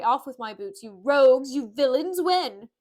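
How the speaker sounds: loud, imperious rebuke; angry scolding. Acted. Petruchio ordering servants to remove his boots, loudly scolding. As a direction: berate them with rising force.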